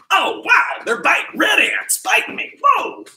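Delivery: high shouted pretend panic; mock yelps of alarm. Coach hamming up ants biting in his socks, high yelps. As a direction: yelp like ants are really biting.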